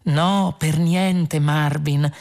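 flute it sweetly and slowly; overdone soothing lilt; fluting. A narrator's reading. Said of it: "zufolò Trillian".

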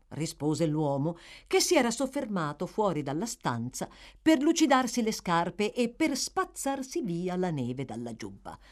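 ordinary delivery, nothing marked

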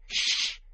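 sharp, voiceless hushing sound; urgent shush. A narrator's reading. Tom hears a noise and silences his friend, urgent shush. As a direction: hush your friend sharply.

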